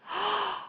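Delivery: sharp, high intake of breath; quick surprised gasp. Real, not acted. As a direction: gasp in delight. Sees pink lights mentioned in chat.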